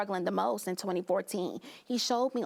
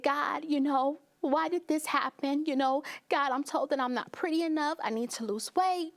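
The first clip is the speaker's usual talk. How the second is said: raised, pleading voice reliving a tearful prayer; pleading with God; 7 semitones higher than usual. Retold or imitated. Guest reliving her closet prayer, pleading high. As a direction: cry out to God again as she did in the closet.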